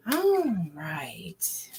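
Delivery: breathy whisper, unvoiced; whispered all right. Real, not acted. Reader whispers to herself before the card reading. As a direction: whisper it to yourself as you focus.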